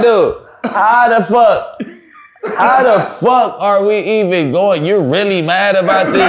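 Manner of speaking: high, amused mock exasperation; laughing at how mad she is; laughing protest. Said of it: "I'm like".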